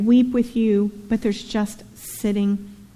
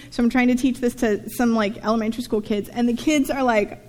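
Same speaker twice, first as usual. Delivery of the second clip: ordinary delivery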